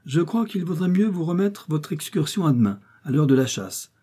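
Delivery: lightly voiced, haughty and mocking; insolent. A narrator's reading. Elfreda sneers at Florence's plan for the walk, insolent. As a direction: look down your nose at her cousin. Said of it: "reprit insolemment miss Elfreda".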